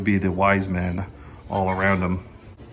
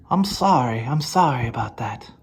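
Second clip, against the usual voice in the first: Stan Laurel whimper. Retold or imitated. Stan Laurel apology voiced.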